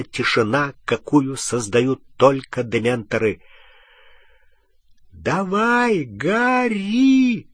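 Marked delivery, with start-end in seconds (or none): 5.1-7.5 faint voice calling from far off; dreamy distant voice. A narrator's reading. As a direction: call as if from a great distance. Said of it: "словно издалека голос Гермионы".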